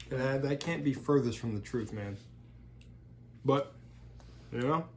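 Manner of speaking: talking through a mouthful; words round a bite of food; mouth full. Real, not acted. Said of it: "Sorry for talking with my mouth full".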